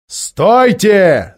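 loud, high command to halt; thundering shout. A narrator's reading. Тирион blocking the dwarfs' path, thundering. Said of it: "загремел Тирион".

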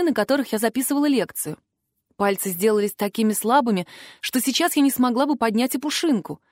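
usual speaking voice

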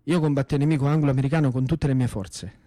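usual speaking voice

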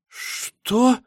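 very high, incredulous "what?"; outraged disbelief. Acted. Girl hears Misha's wedding talk, outraged disbelief. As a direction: shoot way up in pitch, appalled.

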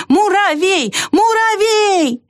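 high, drawn-out calling cry. A narrator's reading. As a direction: hold the last syllable high, calling. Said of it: "кричала белка".

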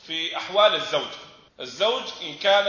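ordinary talk

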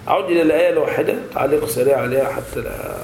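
ordinary delivery

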